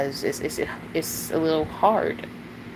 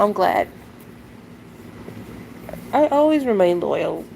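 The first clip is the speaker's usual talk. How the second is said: ordinary talk, nothing marked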